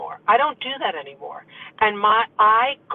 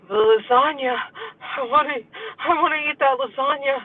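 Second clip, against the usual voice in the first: mock-tearful, higher-pitched craving whine; whining inner craving; 4 semitones higher than usual. Her brain craving a companion's food, mock whining. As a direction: whimper it like a deprived dieter. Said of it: "other half of my brain is like".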